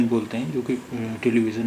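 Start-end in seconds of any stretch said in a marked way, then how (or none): 1.2-1.7 ٹیلی ویژن said "televi-zan", plain z; mispronunciation with z. Shown as a demonstration. Teacher rejecting the common error, ٹیلی ویژن with z. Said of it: "ٹیلی ویژن نہیں ہے".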